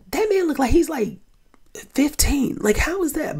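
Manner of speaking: high-pitched stunned disbelief; shocked disbelief. Retold or imitated. Retelling her reaction to the young-looking dad, high and stunned. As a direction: squeak it out, can't believe he looks fifteen. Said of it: "And I was like".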